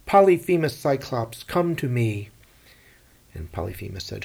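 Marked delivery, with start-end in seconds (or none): 0.1-2.3 loud, higher call across the water; yelled challenge. Acted. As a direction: bellow it out to the giant. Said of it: "he yelled".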